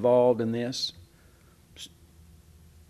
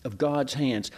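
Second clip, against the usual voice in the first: usual speaking voice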